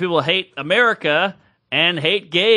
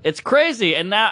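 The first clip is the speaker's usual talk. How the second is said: ordinary talk, nothing marked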